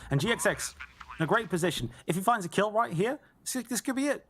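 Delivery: rising excitement, pitch climbing; hyped caster build. Real, not acted. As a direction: build the hype to a peak. Rising hype in clutch round.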